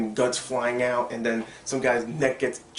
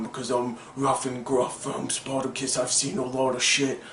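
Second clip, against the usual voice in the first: imitation of an actor's rough, gruff warrior talk. Reviewer mocking the new lead actor's way of talking, rough gruff voice. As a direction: put on a gruff tough-guy voice. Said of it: "this rough, gruff voice".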